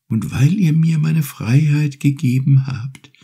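high, gentle turtle voice, unhurried; grateful little turtle. Acted. Turtle thanks Urashima for freeing her, high and gentle. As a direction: offer your thanks like a small polite sea creature.

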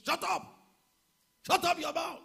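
angry shout. Retold or imitated. Pastor shouting down the enemy's words. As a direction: roar it at the enemy's lie. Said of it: "I said".

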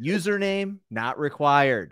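high, measured login prompt; mock website voice. Podcaster playing Philip's website, slow mock computer prompt. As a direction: intone each field like an automated site.

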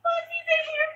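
high loud scared cry; frightened shout. Real, not acted. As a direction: yelp it in fright. Monster appears in game.

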